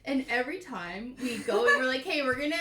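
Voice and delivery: loud, laughing through the words; can't stop giggling as you tell it; laughing while talking. Real, not acted. Recalling their book sorting, laughing loudly.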